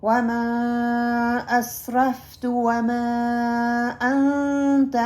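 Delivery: slow, drawn-out melodic dua recitation; slow Quranic recitation. Shown as a demonstration. Teacher reciting a dua for students to repeat. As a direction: recite each word long and measured. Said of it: "اگلی دعا ہے".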